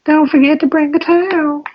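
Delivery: very high, mocking cartoon voice; mock your buddy's squeaky voice; impersonating a friend. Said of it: "an impersonation of you".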